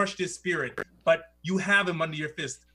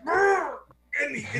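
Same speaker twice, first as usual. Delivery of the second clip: strained cry; 8 semitones higher, 4 dB louder than usual. Acted. NPC being squeezed.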